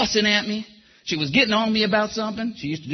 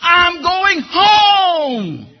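very slow, loud, crying shout; a spirit rising up, shouting with tears; triumphant cry; 5 semitones higher, 6 dB louder, much slower than usual. Retold or imitated. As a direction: stretch it out as a tearful victory call. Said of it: "rose up and said".

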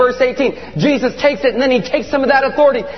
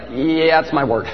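casual matter-of-fact reply; 7 semitones lower than usual. Acted. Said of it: "And he's like".

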